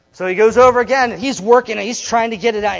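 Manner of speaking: building tension in the story; excited retelling. A narrator's reading. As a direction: race through the struggle. Narrating the struggle excitedly.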